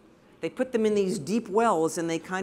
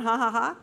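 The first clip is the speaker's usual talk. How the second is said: mock laugh; 4 semitones higher than usual. Shown as a demonstration. Photographer fakes a laugh to crack up the fishermen. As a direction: fake forced ha ha ha. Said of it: "And then I start going".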